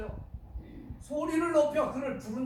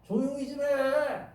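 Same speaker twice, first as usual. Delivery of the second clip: drawn-out, higher-pitched telling-off; acted scolding shush, slow and raised; scolding the crier; 5 semitones higher, 3 dB louder, slower than usual. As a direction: hush someone crossly, stretching it out. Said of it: "크게 소리 지르니까".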